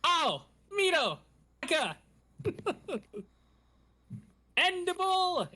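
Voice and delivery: hammed-up sentai attack call, laughing. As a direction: shout the colors as the ball flies. Rangers calling out colors passing ball.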